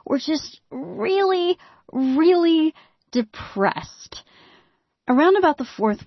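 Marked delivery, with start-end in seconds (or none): 0.1-4.2 really, really dragged out slowly; a slow glum drawl; slow, drawn out. Acted. Dragging out the depressed state.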